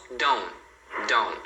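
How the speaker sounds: don't as "don"; dropped final T. Shown as a demonstration. Teacher showing what people say, don't without T. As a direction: end don't on the N, no T. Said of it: "não vão falar esse T final".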